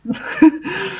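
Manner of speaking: breathy high giggle trailing upward; high helpless giggle. Real, not acted. Reader apologising to viewers, then giggling again. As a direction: laugh it off, high and soft. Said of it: "I apologise for the laughter".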